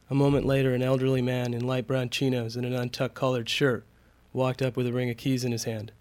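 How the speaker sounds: usual speaking voice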